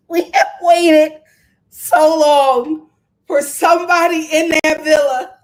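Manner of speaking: loud, insistent proclamation; emphatic exclamation. Real, not acted. Viewer celebrating that someone finally noticed Alondria, emphatic exclamation. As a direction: belt out the long-awaited relief. Emphatic relief after waiting so long.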